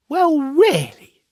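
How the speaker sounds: high, loud, slow exclamation; exclaimed. Acted. Fire balloon cries out at the rocket, high and loud. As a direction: burst out in bright surprise. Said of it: "exclaimed a small fire balloon".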